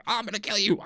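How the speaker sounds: high, hammed-up attacker's threat ending in a shriek; mock killer threat. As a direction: play a pretend attacker giving chase. Acts out the chasing attacker.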